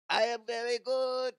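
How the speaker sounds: mock LeBron faking French; bad French accent. LeBron greeting someone in bad French accent. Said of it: "trying to do a French accent".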